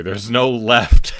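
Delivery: words breaking into a loud laugh. Real, not acted. Streamer with no rule left, laughing out loud. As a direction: laugh helplessly through the line, then dissolve. Stuck without a game rule.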